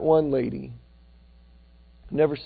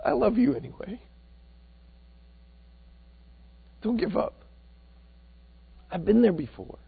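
tearful, soft; 4 semitones higher, 6 dB quieter than usual. Retold or imitated. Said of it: "and cry and say".

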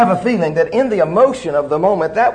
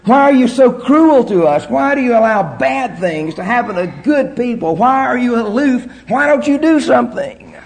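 accusing complaint to God; 8 semitones higher, 3 dB louder than usual. Acted. Preacher voicing people charging God, in a higher, louder accusing cry. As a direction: plead and accuse God at full voice. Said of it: "He is bombarded with charges".

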